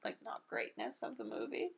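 normal talking voice

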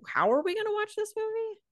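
a squeaky what-do-we-do wail; bewildered question. Retold or imitated. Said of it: "We were kinda like".

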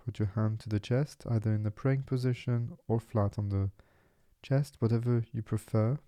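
soft, unhurried meditation voice with long pauses; guided meditation. Acted. Podcast host settles listeners into relaxing body and mind, guided meditation. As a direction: guide them gently into stillness.

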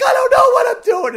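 very high, loud crying shout; high panicked cry of helplessness; panicked wail. Retold or imitated. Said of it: "I'm like".

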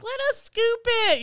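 put-on very high, loud childish plea; begging kids. Grandkids begging grandparents at the food truck, high childish plea. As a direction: beg like an eager little kid. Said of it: "we're like".

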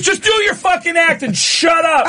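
high-pitched mock furious yell; violently angry heckler. Angry guy answers the comic, high furious yell. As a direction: imitate an irate heckler yelling at the stage. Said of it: "sounds violently angry".

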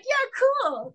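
exaggerated bubbly yes; mock eager agreement. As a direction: play it falsely eager, like a willing puppet.

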